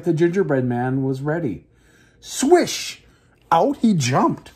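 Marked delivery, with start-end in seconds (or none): 2.2-4.5 high, excited burst; excited exclamation. A narrator's reading. Narrator showing the gingerbread man leaping out, excited. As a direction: pop the words out like a sudden leap.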